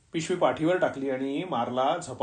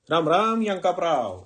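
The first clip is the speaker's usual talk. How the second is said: higher-pitched, friendly calling-out; familiar hail; 4 semitones higher than usual. A narrator's reading. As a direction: hail an old acquaintance by name. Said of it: "अशा सलगीनं हाक मारणारं".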